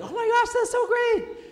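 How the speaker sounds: very high, loud mock squeal; over-the-top delighted shriek, imitating a thrilled friend; excited gushing. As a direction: gush in a hammed-up high-pitched squeal. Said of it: "it's so exciting".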